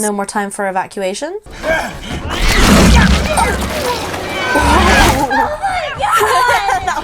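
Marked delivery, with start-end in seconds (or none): 1.4-6.8 high shrieking laugh, loud; loud burst of laughter. Real, not acted. Loud laughter while watching.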